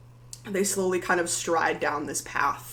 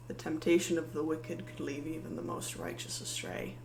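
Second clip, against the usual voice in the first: soft, measured paladin threat, softer; quiet menace; 6 dB quieter than usual. Acted. Paladin quietly accuses Maddie of aiding monsters, soft menace. Paladin demands they kneel.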